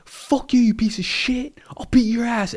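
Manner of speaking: high, hissed mock threat; mock threatening yell. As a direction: imitate a hothead threatening. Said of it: "this dude was yelling".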